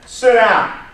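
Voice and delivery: loud, high command, acting the interviewer; imitated loud order. Acted interviewer ordering applicant to sit.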